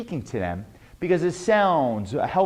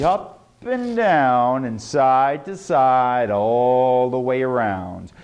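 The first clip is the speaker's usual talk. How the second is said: slow, stretched singsong drill; rolling, falling, drawn-out phrases; exaggerated exercise; 4 semitones lower, slower than usual. Shown as a demonstration. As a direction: carry every word out long. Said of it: "exactly the way I did".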